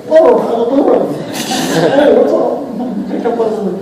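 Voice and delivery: high, goofy chicken monster chatter. Acted. Actor being stupid while meeting cast and crew, high-pitched. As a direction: clown around in the high monster voice. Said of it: "using that voice".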